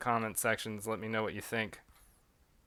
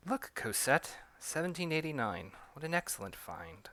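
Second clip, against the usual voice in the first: put-on butler speaking in a British accent; proper British accent for the servant; light British accent, pleased with the find; 4 semitones higher, 4 dB quieter than usual. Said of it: "switching back between a British and French accent".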